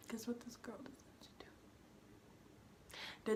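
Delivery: slow whisper, drawing the listener in; conspiratorial whisper. Real, not acted. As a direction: lean in and whisper the setup slowly. Conspiratorial setup before the twist.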